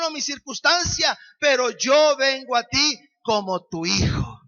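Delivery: preaching. Acted. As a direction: press it on the listeners.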